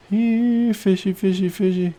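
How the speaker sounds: high singsong here fishy; playful singsong coaxing the fish; coaxing call. Pretend. Calling the fish.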